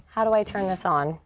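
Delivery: ordinary talk, nothing marked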